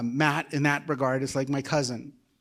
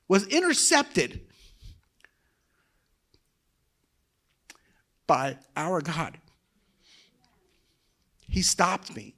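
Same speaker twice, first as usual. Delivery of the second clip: real emotion welling up, halting between phrases; emotional; 4 semitones higher, 3 dB louder than usual. Real, not acted. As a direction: testify while fighting to hold it together. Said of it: "Sorry, I'm pretty emotional".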